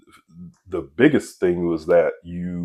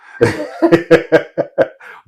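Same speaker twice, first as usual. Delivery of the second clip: laughing; 12 semitones higher, 11 dB louder than usual. Real, not acted. Laughs after crinkly voice.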